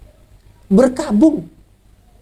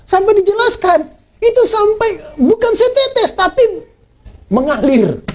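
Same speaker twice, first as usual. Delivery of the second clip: preaching fervour; 10 semitones higher, slower than usual. Acted. Preacher building on weeping.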